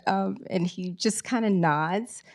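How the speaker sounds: ordinary delivery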